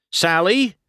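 high searching call, rising; Sally called with a questioning lift. A narrator's reading. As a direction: call Sally as if looking for her. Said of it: "McQueen called".

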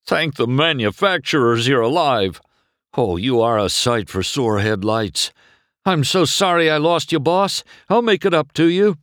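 overwrought, emotional character voice; tearful. A narrator's reading. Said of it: "His voice was thick with tears".

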